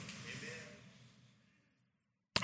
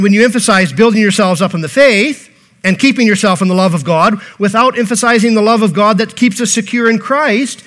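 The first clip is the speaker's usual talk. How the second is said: normal talking voice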